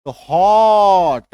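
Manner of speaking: हॉट drawn out long and loud with an open o; हॉट said slowly and loudly as a model; demo of hot. Shown as a demonstration. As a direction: stretch हॉट slowly for the class. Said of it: "जैसे हम लोग बोलते हैं ना हॉट".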